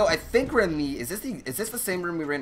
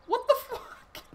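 squeaky high disbelief; shocked; slower than usual. Real, not acted. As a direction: blurt it out in shock. Shocked at teammates' play.

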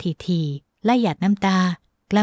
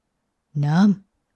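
hoarse murmur; 2 semitones lower, much slower than usual. Acted. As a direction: croak it from a parched throat. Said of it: "น้ำเสียงแหบพร่าหลุดจากริมฝีปาก". (เสียงต่ำ แหบพร่า)

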